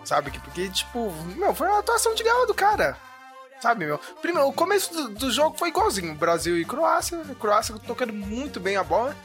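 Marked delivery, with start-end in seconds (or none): none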